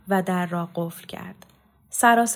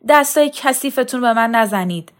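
loud, raised-pitch outburst; angry warning; 5 semitones higher, 8 dB louder than usual. A narrator's reading. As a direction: shout off the people grabbing you. Brother fights off women grabbing him. (هشدار بلند و خشمگین)

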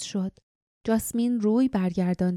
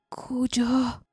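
a slightly hoarse, higher, dazed voice; hoarse mumble; 5 semitones higher, much slower than usual. A narrator's reading. Dazed injured man coming round by the fire, hoarse mumble. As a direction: mumble it, voice a little choked. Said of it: "با صدای گرفته منمن کرد".

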